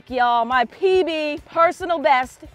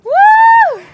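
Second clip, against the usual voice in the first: high, loud whoop; one sustained, high celebratory yell; triumphant whoop; 13 dB louder than usual. Real, not acted. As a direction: let out a real victory whoop. Celebrating a fish landed.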